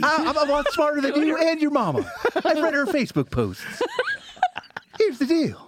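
high put-on comeback, amused; hammed-up high voice, laughter near the surface; mock rescued man.

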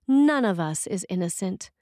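ordinary talk, nothing marked

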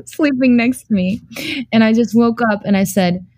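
ordinary talk

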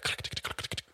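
whispered mimicked keyboard clicking; typing clicks. Manager typing up his ticket, whispered clicking sounds. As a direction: click it out under your breath.

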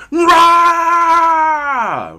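explosive high roar, very loud; rage monster roar. Acted. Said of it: "Rage monster".

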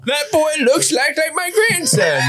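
put-on high-pitched comic old person; hammed-up grandparent spotting someone; nursing-home elder. Plays nursing-home viewer seeing the ad.

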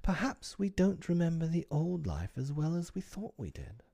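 put-on high-pitched, uncertain girl's voice; faltering. A narrator's reading. Wendy doubting their memory of home, faltering. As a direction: venture it hesitantly, pitched high. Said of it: "Wendy said falteringly".

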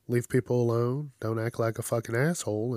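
normal talking voice

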